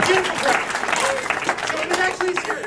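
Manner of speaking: loud, high, drawn-out shout; calling over applause. Real, not acted. Guest greets the applauding audience, calling out loudly. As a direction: call it out slowly to a roaring crowd.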